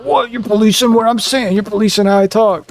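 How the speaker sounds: high, indignant imitation of a coworker; mock offended coworker. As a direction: whine it back like they're the victim. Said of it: "And they're like".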